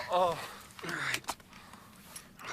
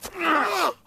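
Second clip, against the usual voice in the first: sharp gasp; 6 dB louder than usual. Acted. Shocked gasp.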